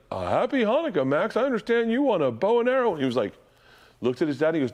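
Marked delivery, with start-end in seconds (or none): 0.1-2.9 high, cheery singsong Santa; imitating Santa. Uncle as Santa promises the boy a gift, high and jolly. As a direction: pitch it up jovially for a child. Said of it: "And he's like".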